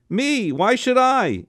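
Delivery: high indignant protest. A narrator's reading. Uncle protesting being sent to the door. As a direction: balk at the idea.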